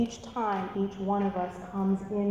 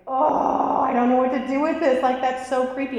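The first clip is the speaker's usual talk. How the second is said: creeped out; 5 semitones higher, 8 dB louder than usual. Retold or imitated. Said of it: "And so he's like".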